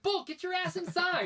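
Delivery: very high, loud retold order, drawn out; exasperated yell. Worker ordering stubborn Bolt inside, mock exasperated yell. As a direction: act out losing patience with a dog. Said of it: "I'm like".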